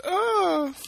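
sudden high frightened "Oh"; self-mocking terrified squeal; mock scared scream. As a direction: shriek it like the scaredy-cat in the haunted house. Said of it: "who's screaming three times more".

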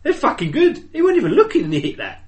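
high, loud burst of enthusiasm; excited raving. Real, not acted. Podcaster raving to co-host about a lucky shot, excited. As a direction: rave at the top of your voice.

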